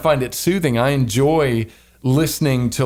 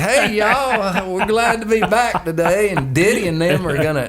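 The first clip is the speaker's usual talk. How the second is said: put-on high-pitched bayou Southern accent; thick Southern accent; 7 semitones higher than usual. Host mimicking their old radio show, thick Southern accent. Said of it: "sound like we pulled you out of the bayou".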